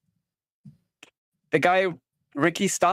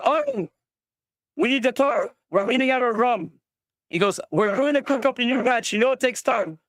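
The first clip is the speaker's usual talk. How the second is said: higher, chatty local asking for a favour; eager villager; 7 semitones higher than usual. Acted. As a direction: pitch it up and bargain casually. GM voices rum-making NPC.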